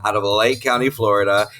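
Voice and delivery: usual speaking voice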